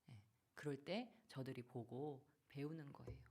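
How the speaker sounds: usual speaking voice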